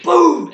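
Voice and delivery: loud, high "boom"; imitated crash. Retelling being slammed through the door, imitated crash. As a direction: punch out a booming impact noise.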